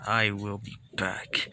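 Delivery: low, soft menacing promise; low villain threat. Acted. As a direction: mutter the threat darkly.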